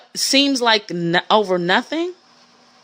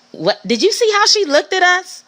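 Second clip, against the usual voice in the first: put-on catty club girl; 8 semitones higher than usual. Mocking a paranoid club girl.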